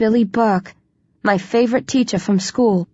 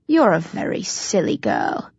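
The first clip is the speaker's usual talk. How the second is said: sneering; 2 semitones lower, 3 dB quieter than usual. A narrator's reading. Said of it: "sneers at me".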